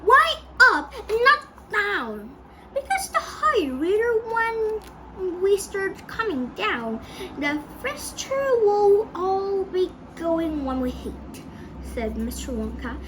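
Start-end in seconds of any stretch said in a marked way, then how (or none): 0.1-2.3 acted screaming question. A narrator's reading. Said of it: "they all screamed at once". 2.7-11.4 high, loud, stretched-out delivery; raised, emphatic character voice; excited explanation. A narrator's reading. Wonka excitedly explaining the dive.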